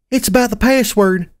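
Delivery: high-pitched little pony voice; Serenity's child voice. Acted. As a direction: call to Mama in a high child's voice. Serenity speaking to her mother.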